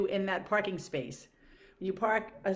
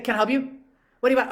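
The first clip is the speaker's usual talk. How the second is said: sharper and louder, higher pitch; imitating the rushed shop woman, louder; mock impatient clerk; 5 semitones higher, 9 dB louder, much faster than usual. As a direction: snap it like she can't be bothered.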